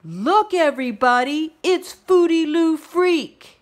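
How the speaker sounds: high, loud jeering shout; loud, fast schoolyard bully taunt; mocking shout. A narrator's reading. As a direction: taunt her in front of everyone.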